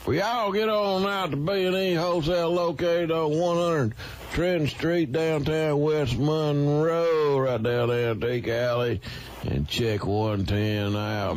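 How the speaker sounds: fast, loud pitchman plug; hyped ad read. Acted. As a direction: rattle off the address like a car-lot ad.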